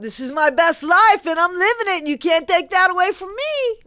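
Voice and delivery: high, loud mocking imitation. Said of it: "or however she says it".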